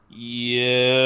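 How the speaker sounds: low, slow hulking voice; slow and looming, like a concrete giant; slow golem voice. Acted. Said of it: "slowly says".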